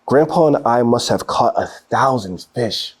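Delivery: plain reading voice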